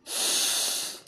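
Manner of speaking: heavy breathy sigh; real swooning exhale; long sigh. Real, not acted. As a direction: let out a long sigh at the memory. Recalling lips touching.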